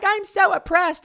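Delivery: high-pitched mock self-pity.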